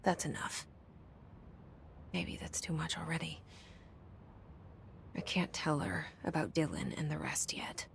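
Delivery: hushed private thoughts, half-whispered; inner-voice whisper. Acted. Jesse muttering her private thoughts in a hushed whisper. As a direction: keep it to yourself, barely voiced.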